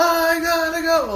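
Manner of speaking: put-on very high, loud kid scream. Imitating little cousin on the slide, screaming. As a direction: stretch out a piercing mock scream. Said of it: "he's screaming at the top of".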